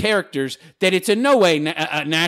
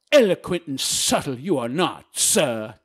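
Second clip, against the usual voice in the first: put-on haughty, eloquent butler voice; hammed-up snooty servant; mock snooty butler; 3 semitones lower than usual. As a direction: look down your nose, mock-refined. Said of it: "in my very eloquent and snooty way".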